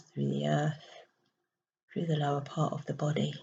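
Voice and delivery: soft, hushed meditation voice with long pauses; guided meditation. Acted. Spiritual coach guiding listeners to breathe into the heart, soft meditation voice. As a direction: guide them gently through each breath, softly and unhurriedly.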